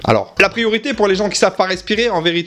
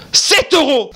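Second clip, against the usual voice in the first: high, outraged exclamation; hammed-up indignant cry; angry outburst; 8 semitones higher, 3 dB louder, slower than usual. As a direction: burst out in disbelief and anger. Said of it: "ça m'a mis colère".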